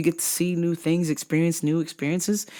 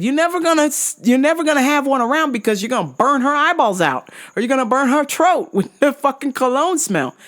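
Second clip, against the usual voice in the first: mocking rant; 9 semitones higher than usual. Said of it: "I said".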